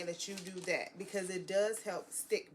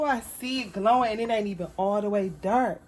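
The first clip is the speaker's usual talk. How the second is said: usual speaking voice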